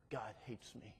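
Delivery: put-on preacher echoing a girl's hopeless words; quiet despair. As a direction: let it fall to a near murmur.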